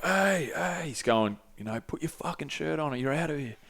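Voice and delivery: lightly voiced Brazilian bouncer, hushed warning; Portuguese bouncer. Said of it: "In Portuguese".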